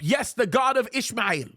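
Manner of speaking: normal talking voice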